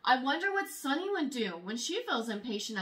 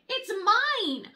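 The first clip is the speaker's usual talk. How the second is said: put-on childish protest; 7 semitones higher, 5 dB louder than usual. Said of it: "I want to say".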